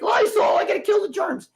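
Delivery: high, loud, frantic shopper parody; mock panic. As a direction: squeal it like a germ-obsessed hoarder.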